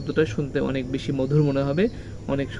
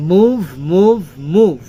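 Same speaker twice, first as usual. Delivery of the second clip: each মুভ louder, both consonants pressed; stress on MOVE; 6 dB louder, much slower than usual. Shown as a demonstration. Teacher showing pupils both stressed sounds in মুভ. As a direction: punch মুভ from m through v. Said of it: "দুইটাকে stress দিয়ে আমরা বলেছি".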